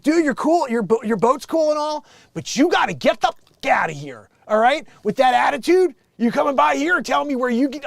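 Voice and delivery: high, loud, indignant pushback; mock telling-off. Dock worker telling yacht owner to leave, indignant. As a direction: act out a fed-up dock worker.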